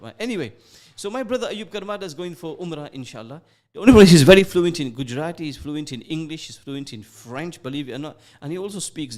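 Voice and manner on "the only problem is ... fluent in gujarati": 1 burst of volume. Real, not acted. Joking about friend's languages.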